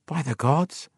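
stunned, muttered oath; awed mutter. A narrator's reading. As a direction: mutter it in dawning disbelief. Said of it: "he muttered".

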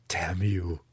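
whispered, bitter curse; hissed curse. A narrator's reading. Young drow whispering a bitter curse at his teacher. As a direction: breathe the curse at him. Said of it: "Drizzt spat back".

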